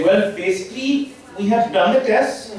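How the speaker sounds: a lightly hammed-up Indian doctor giving a diagnosis; Indian doctor accent. Comic playing an Indian doctor explaining test results to a patient. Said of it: "Indian doctors".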